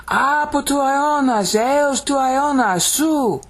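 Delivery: high, slow, sustained Greek declamation; dramatic proclamation. Shown as a demonstration. Teacher declaiming the verse in Greek, dramatic. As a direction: intone it as one soaring line. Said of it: "It's dramatic".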